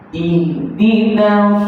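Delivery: "Ihdinas" drawn out slowly, final s heavy as sad. Shown as a demonstration. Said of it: "Naik lidah".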